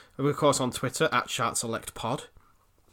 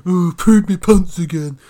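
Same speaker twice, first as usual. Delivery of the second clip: silly mocking voice; 6 semitones higher, 10 dB louder than usual. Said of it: "Stu stomping around in his undies going".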